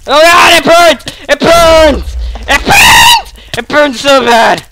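high, loud yells of mock pain; hammed-up agony. Gamer whose character is on fire, mock agonised shouting.

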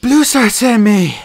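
high, loud, slightly winded delivery; panted. A narrator's reading. Firepaw, winded from catching up, explains himself to Whitestorm, panting. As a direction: push it out slowly as if short of breath. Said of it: "he panted".

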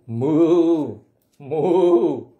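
long, loud drawn-out moo; cow mooing. Acted. Reader voices the cow for children, mooing. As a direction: moo like a cow, nice and full. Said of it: "said the cow".

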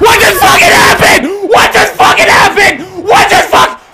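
furious shout. Real, not acted. Rage at game outcome.

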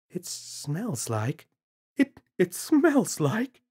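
she realizes what the smell is; dawning horror. Acted.

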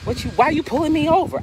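high, sassy indignant challenge; mock-indignant young driver protesting the stop; smart-mouthed. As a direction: snap back at the cop, defiant. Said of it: "I used to get really smart with the police".

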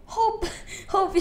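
laughing in disbelief at the screen. Real, not acted. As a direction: giggle out the word. Laughing at the messages.

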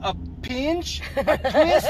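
high, loud mock scold; a hammed-up stern Oma; mock grandma punishment.

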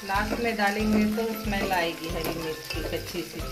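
normal talking voice

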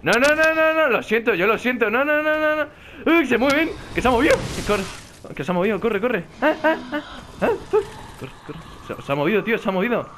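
panicked high shrieks; being chased. Real, not acted. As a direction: scream while fleeing, pitch up. Perseguido por árboles en el juego. (gritos de pánico al huir)

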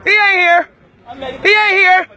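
loud, punchy real shout; angry shout. Real, not acted. Heated confrontation in a church.

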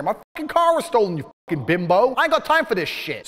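loud, high, furious yelling at the police; furious outburst. Retold or imitated. To the policewomen about his stolen car, an angry shout. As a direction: blow up at the useless officers.

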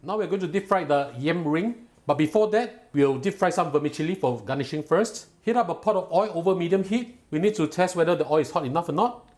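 ordinary talk

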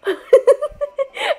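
very high, giggling laugh; gloating laugh. Real, not acted. Prankster after claiming to be future husband, high gleeful laugh.

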